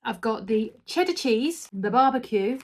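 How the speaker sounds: ordinary talk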